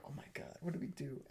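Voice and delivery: barely voiced, worried whisper; hushed worry. Two friends outside the bathroom as he vomits, hushed worry. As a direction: murmur it under your breath, worried.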